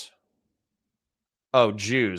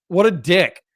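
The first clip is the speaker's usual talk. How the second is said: annoyed outburst; 5 semitones higher, 5 dB louder than usual. Real, not acted. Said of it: "sorry for getting so mad".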